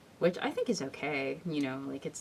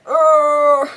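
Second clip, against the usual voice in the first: high, loud drawn-out oh; loud surprised oh; 11 semitones higher, 18 dB louder than usual. Real, not acted. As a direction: react in loud shock.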